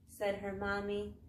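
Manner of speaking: plain reading voice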